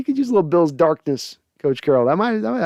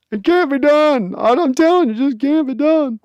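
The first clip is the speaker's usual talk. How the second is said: insistent executive; 11 semitones higher, 3 dB louder than usual. Acted. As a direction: plead that it's impossible, pitched way up. Voiced exec insisting, skit.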